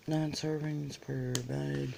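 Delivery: ordinary delivery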